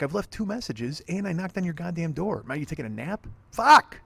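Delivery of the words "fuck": furious shout, much higher and louder; angry yell in the courtyard; real yell. Real, not acted. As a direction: bellow it in pure frustration. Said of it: "I'm yelling now in my courtyard".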